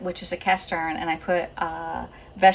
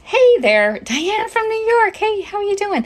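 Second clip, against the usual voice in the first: loud, high-pitched hello to a friend; high, gushing welcome; excited greeting; 9 dB louder than usual. Real, not acted. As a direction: call out brightly to a viewer just arriving. Greeting a viewer joining the live.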